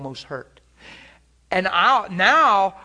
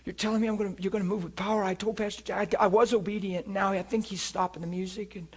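soft, hushed plea to God; a hint of desperate whisper-prayer; quiet inner prayer; 2 semitones higher, 7 dB quieter, faster than usual. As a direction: pray under your breath, anxious. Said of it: "in my heart, I said".

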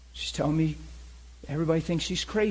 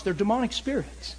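ordinary talk

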